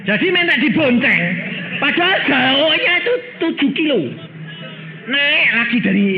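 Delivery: high, loud preacher's cry; preaching at full force. Acted. Preacher tells the congregation about the long ride, loud and high. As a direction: marvel at it out loud.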